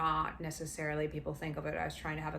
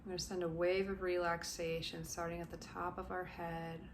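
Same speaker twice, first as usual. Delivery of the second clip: guided meditation. Acted. Coach guiding listeners through body relaxation, gently soothing. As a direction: ease them down into calm, step by step.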